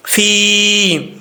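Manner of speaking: فی held one alif long; long vowel demo. Shown as a demonstration. Teacher models the lengthened vowel, held demo. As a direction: stretch فی out evenly. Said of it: "ایک الف کی مقدار لمبا کریں گے".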